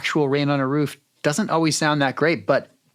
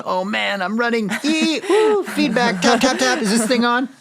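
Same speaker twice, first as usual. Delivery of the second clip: high, louder imitation of the skit; imitating Jess's skit; 11 semitones higher, 4 dB louder than usual. Guest imitating host's sound skit, high and playful. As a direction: replay her sound cues playfully. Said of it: "sounding out what the script would say".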